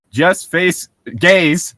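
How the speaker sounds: normal talking voice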